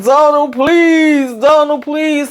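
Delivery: high, wailing hammed-up plea; mock pained begging. Lily pleading with her husband for a pill, mock pained begging. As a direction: beg in an exaggerated whine.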